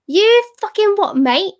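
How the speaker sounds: mock East End London accent. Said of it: "I love going a bit East End on ya".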